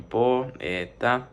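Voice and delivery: "po, eta" slowly, syllables apart; dividido em sílabas. Shown as a demonstration. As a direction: break "po, eta" into po, e, ta. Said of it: "Se divide en".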